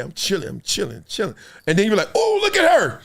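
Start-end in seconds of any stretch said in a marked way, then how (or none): 0.0-1.3 low, soft mock nonchalance; playing it cool. At his table at the party, laid-back mock cool. 2.1-3.0 high, sudden mock awe; mock excited noticing. Man at a party spots a woman, high mock excitement.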